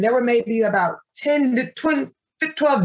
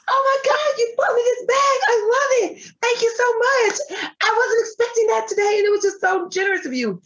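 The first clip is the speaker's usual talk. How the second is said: much higher, crying gushed thanks; tearful thanks; 12 semitones higher than usual. Retold or imitated. A thrilled stranger thanking her through tears, high and tearful. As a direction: replay a stranger sobbing her gratitude.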